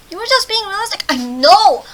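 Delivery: high, loud exasperation; exasperated outburst. Acted. Mock exasperation at game characters.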